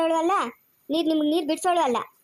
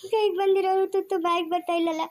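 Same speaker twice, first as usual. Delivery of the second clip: tearful lament; 3 semitones higher than usual. Acted. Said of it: "ಯಾಕೆ ಅಳ್ತಾ ಇದೀಯ?".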